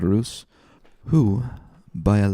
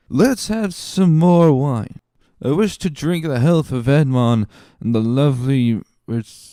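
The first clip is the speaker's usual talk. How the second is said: tipsy toast; 6 semitones higher, 4 dB louder than usual. Acted. Drunk Caderousse calling for more wine, high and slurred. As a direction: raise a wobbly glass.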